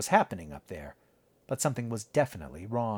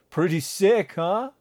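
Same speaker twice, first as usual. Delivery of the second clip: a high, amused instructor's line; laughing instructor; 8 semitones higher, 6 dB louder, slower than usual. Acted. Instructor to Natalie mid-flight, amused and laughing. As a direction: toss it off with a laugh in his voice. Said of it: "laughed and said".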